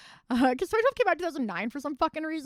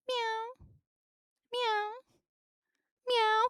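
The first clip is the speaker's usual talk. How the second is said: imitating cat meow; 10 semitones higher, much slower than usual. Streamer imitating her cat meowing in the doorway, high mock meows. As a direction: mimic a cat meowing, pitched up. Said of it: "and just".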